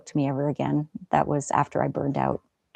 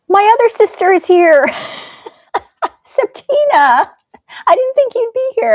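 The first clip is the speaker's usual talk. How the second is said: high, loud, thrilled greeting; real excitement at a surprise visitor; delighted surprise; 11 dB louder than usual. Real, not acted. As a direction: squeal with joy at seeing your sister. Sister unexpectedly joins the stream.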